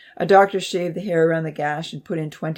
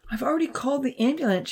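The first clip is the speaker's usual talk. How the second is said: shaky; 8 semitones higher, faster than usual. A narrator's reading. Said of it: "she said shakily".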